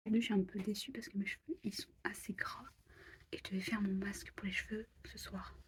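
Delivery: soft unvoiced whisper; library whisper. Real, not acted. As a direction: whisper so nobody wakes up. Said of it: "Je parle tout bas parce que Léo". (chuchotement discret)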